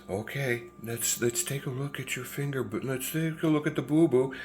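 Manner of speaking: soft, high, coaxing nurse voice; gently baby-talk a hurt toddler; imitated nurse, hushed and soothing; pretty nurse. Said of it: "a pretty nurse comes out".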